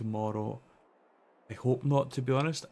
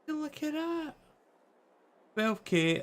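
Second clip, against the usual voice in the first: excited; slower than usual. Real, not acted. Excited about money total.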